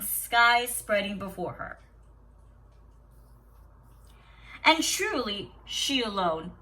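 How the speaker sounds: steady storybook reading to a class; story read aloud. Acted. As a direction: read the tale calmly to the children. Teacher reads story aloud.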